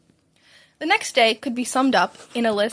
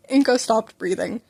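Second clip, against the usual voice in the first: plain reading voice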